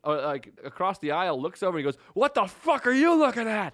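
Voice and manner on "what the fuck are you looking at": high, loud confrontational shout; angry yell. Retold or imitated. Imitating the passenger snapping at an old man, angry yell. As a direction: yell it as a challenge, imitating a hothead.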